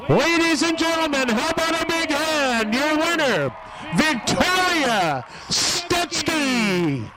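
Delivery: high, drawn-out crowd announcement; announcer call. Real, not acted. Track announcer presenting the winner to the fans, ringing and drawn out. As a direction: sell the winner to the whole grandstand.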